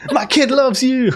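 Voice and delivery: high, loud whooping cheer; a citizen cheering from the wagon, loud and high; cheering shout. Acted. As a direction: holler it out like an excited fan.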